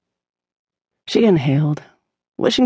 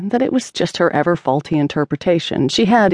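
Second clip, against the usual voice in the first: ordinary delivery, nothing marked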